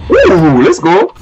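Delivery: loud, high celebratory whoop; excited cheer. Real, not acted. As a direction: cheer loudly, pitched well up. Celebrating a win in game.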